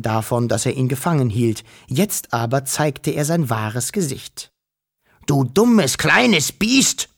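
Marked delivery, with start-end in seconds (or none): 5.3-7.1 loud, rising angry yell at the little creature; furious shout. A narrator's reading. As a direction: bark it out, losing his temper. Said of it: "schrie er plötzlich". (wütend geschrien, laut und hoch)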